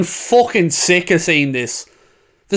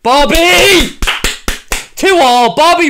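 loud, high cheer; goal celebration; 8 semitones higher, 4 dB louder, slower than usual. Real, not acted. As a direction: roar his name for the equaliser. Celebrating the equaliser.

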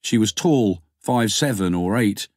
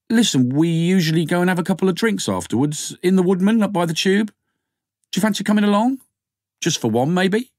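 a hint of a hopeful young man, pitched higher; lightly voiced Alan inviting; 9 semitones higher than usual. A narrator's reading. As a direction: ask her out casually, a bit eager. Alan invites her for drinks.

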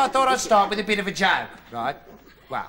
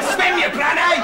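loud, high mock bystander yell; shouted order; 9 semitones higher, 6 dB louder, slower than usual. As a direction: shout it urgently at a struggling swimmer. Mimics bystanders shouting at drowner.

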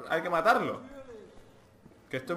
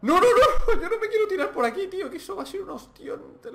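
much higher, loud, fast laughing protest; laughing panic; 6 dB louder than usual. Real, not acted. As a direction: squeal it out laughing and scared. Panico ridendo prima del salto.

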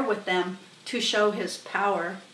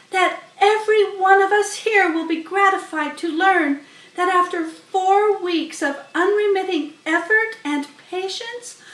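Charlotte's voice; 8 semitones higher, 5 dB louder than usual. Acted. Charlotte announcing the goslings to the barn, high and grand.

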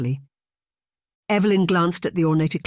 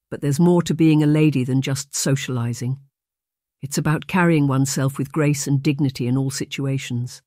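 usual speaking voice

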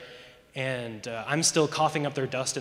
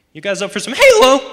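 put-on teen invitation that breaks into a high squawk; voice crack; 9 semitones higher, 14 dB louder than usual. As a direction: voice cracking loudly on Halo. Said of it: "Voice cracks?".

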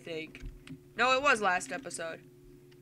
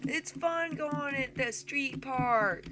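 put-on high, drawn-out kid voice; happy son; 6 semitones higher, much slower than usual. Son riding along with Dad, high slow kid voice. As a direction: play an excited little boy.

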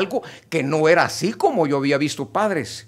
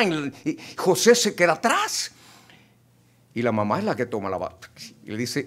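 ordinary delivery

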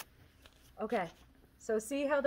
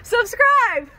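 loud call; 11 semitones higher, 9 dB louder than usual. Real, not acted. Shouted subscribe plea.

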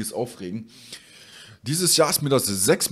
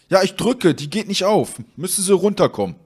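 higher-pitched imitation of a frustrated courier; mock exasperated complaint; 6 semitones higher, 3 dB louder than usual. Courier complains to customer over intercom, mock exasperated. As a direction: protest that it will not work.

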